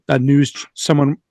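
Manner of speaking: ordinary delivery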